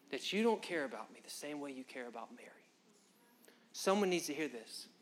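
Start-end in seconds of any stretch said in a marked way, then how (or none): 0.1-2.7 soft, wounded whispered confession; whispered hurt. Retold or imitated. Martha whispers to Jesus that she feels unloved. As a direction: let the hurt slip out in a whisper. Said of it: "She said".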